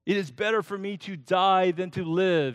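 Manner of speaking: high, loud, desperate cry to God; furious plea. Acted. Jonah cries out to the Lord, furious and loud. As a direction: beg to die in a furious outburst. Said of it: "He is just furious".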